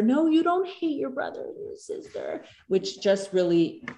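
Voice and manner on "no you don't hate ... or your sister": high-pitched mock parental denial; put-on, singsong scolding, much higher; dismissive parent. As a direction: imitate a parent protesting in a high voice. Acted parent contradicting an upset child.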